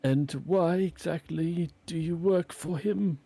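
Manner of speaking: a hint of a cautious adventurer; lightly voiced wary hero. Hero questions wolf.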